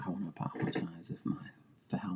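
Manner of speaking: hushed, breathy apology; whisper. Real, not acted. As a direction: drop to a whisper. Apology for her foul mouth.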